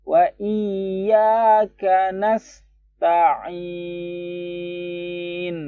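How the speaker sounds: very slow, high chanted tilawah; Quran recitation. Shown as a demonstration. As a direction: draw out nasta'in with flowing s. (tilawah sangat pelan, s-nya mengalir)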